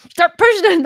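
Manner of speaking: loud, high burst of frustration; mock impatient outburst; frantic pushing. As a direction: act out stabbing the button impatiently. Frustrated person jabbing elevator button.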